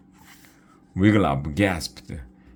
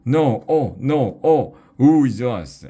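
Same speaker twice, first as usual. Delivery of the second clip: higher, louder wailing cries; dismayed shouting; 7 semitones higher, 5 dB louder than usual. A narrator's reading. Angus shouting in dismay at his cousins. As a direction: cry out in horror at the sight. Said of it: "Angus began shouting".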